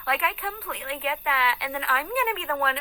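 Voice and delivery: high, exaggerated mocking voice; put-on high voice. Woman ranting about justice, in a high put-on mocking voice. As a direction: push it up high and end on a fake giggle. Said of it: "Why is she talking like that?".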